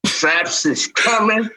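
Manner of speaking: slow, drawn-out tease; teasing announcer. Acted. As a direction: stretch it out like a reveal. Teasing the strap reveal.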